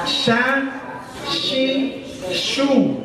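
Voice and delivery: "sha, Shi, shu" high and soft; teacher modelling "sha, Shi, shu"; drilled syllables. Shown as a demonstration.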